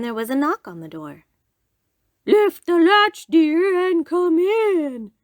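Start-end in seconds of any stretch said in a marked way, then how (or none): 2.3-5.1 very high, loud, drawn-out fake granny call; wolf posing as Grandmother, pitched way up; grandmother's voice. A narrator's reading. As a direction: call out slowly in a put-on granny voice. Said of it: "called the wolf in Grandmother's voice".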